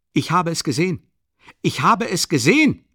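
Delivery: high, loud insistent shout. Acted. Witness yells that he saw it. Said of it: "schrie Lebesjetnikow".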